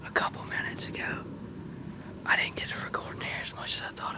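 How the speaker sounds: hushed, excited hunter's whisper. Real, not acted. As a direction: whisper the story so nothing in the woods hears. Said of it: "I don't even know I'm whispering".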